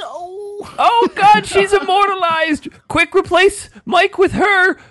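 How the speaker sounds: high, mock-thrilled exclamation; excited squeal. Real, not acted. Excited about the cat.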